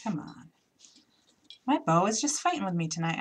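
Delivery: ordinary talk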